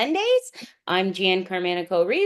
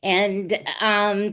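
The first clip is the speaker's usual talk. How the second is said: ordinary talk, nothing marked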